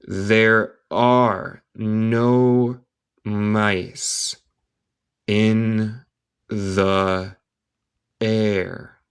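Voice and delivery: very slow, each word held and separated; word-by-word dictation. Shown as a demonstration. Teacher reading a line to English learners, word by word. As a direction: leave a gap after each word.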